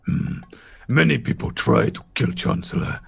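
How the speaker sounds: gruff guard voice in broken English; a simple-spoken bodyguard talking plainly; broken-English warrior. Acted. Tolgar continues.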